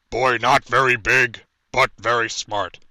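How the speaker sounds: slow, loud booming Hulk voice; big dumb brute, every word heavy; dramatic reading. Acted. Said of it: "I'm gonna attempt to do a dramatic reading here".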